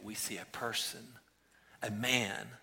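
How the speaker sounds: ordinary talk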